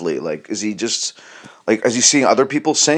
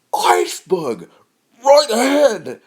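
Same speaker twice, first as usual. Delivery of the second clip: failed fearful try; 12 semitones higher, slower than usual. Pretend. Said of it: "I can't even say it now how I want to say it".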